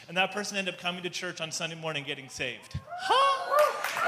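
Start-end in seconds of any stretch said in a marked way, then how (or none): 3.0-4.1 high, loud surprised yelp; a shrieked, disbelieving huh; mock astonished huh. Stunned reaction to a saved stranger.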